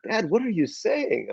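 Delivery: put-on high, slow baffled kid; mock-baffled child's protest; puzzled kid. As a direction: lightly imitate your kid questioning you. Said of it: "And he's like".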